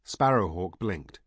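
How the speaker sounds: plain reading voice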